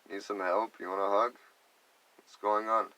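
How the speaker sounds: normal talking voice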